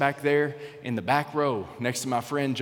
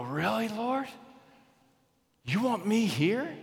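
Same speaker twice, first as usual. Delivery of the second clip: amazed disbelief; 8 semitones higher, slower than usual. Pretend. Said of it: "we're gonna go".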